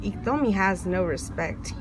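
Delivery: ordinary delivery, nothing marked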